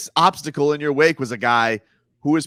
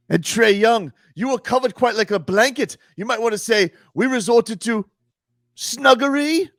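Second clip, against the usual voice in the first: mock poem; 6 semitones higher than usual. Mock rhyming roast, sing-song.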